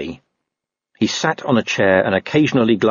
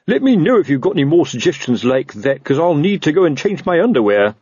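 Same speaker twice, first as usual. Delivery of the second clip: higher-pitched sour sergeant; bitter sarcasm; 7 semitones higher, 3 dB louder than usual. A narrator's reading. Sergeant frightened by friend's advice, mildly bitter. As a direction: invite more suggestions with heavy irony. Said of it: "he said bitterly".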